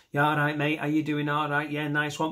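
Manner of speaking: one-note Mancunian with a swaying rhythm; rhythmic monotone Mancunian. Shown as a demonstration. Comic greeting a mate with natural sway, monotone Mancunian. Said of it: "there's a rhythm to it".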